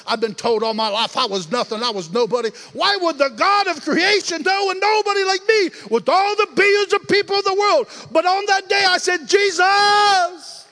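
climbing from loud to a high shouted cry; building preaching climax. Acted. Preacher crying out his conversion to the church. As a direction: build the testimony to a roar.

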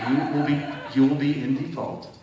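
hammed-up collection letter; mock stern warning. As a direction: read the threat out loud and hard, mocking it. Reading the bank's threat letter.

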